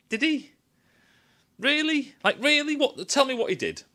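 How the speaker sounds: high-pitched disbelieving questions; squeaky sceptical grilling; incredulous. Real, not acted. Challenging the praise with incredulity.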